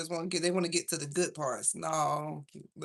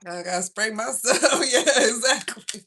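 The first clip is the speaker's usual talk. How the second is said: laughing; 6 semitones higher, 8 dB louder, slower than usual. Real, not acted. Still laughing at chat.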